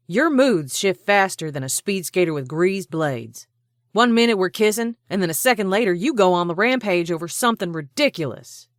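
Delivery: loud, rising, fast tirade; scolding rant. A narrator's reading. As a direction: berate him without a breath. She berates his mood swings.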